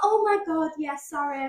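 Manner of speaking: high, gushing "oh my God" apology; flustered client. Retold or imitated. Stylist mimicking a client caught not paying, high and gushing. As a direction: act caught out and falsely sorry.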